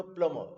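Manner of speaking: plumber said PLUM-uh; silent b and r. Shown as a demonstration. Teacher giving the right form, plumber as PLUM-uh. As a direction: plumber without b, ending on "uh". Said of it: "बी भी नहीं बोलना है".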